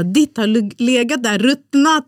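retold husband's gross-out theory, ending on a big mock shout; building mock horror. Telling her friend about her husband's theory, building to mock disgust.

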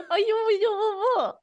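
high, cooing pet voice; baby talk to cat. Shown as a demonstration. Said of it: "you're just like that".

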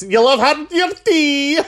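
slow, high, posh Edinburgh Scots; mock Edinburgh accent. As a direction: drawl it like a prim Edinburgh lady. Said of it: "neat accent that was".

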